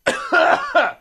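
high cough after choking; real choking cough. Real, not acted. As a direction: cough it out, windpipe irritated. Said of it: "Went down the wrong pipe".

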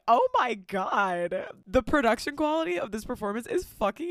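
a hammed-up impressed crowd; mock awe. Parents at the party, mock amazed. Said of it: "all the parents are like".

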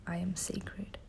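faint whispered repeat; whispered echo. Shown as a demonstration. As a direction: whisper it back softly. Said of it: "Say it with me".

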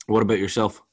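normal talking voice

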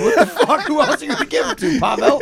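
high, loud laughing outburst; cracking up in disbelief at his friend. Real, not acted. Laughing at his friend for leaving the books to someone else, high and loud.

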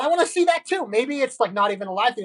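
ordinary talk, nothing marked

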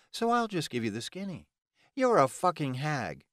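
high-pitched mocking comeback; scornful Delilah. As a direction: insult the rival with sarcastic contempt. Delilah mocks her rival.

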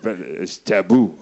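slow, low taboo warning; mock ominous. Echoing the joke, slow ominous warning. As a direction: drag it out like a curse.

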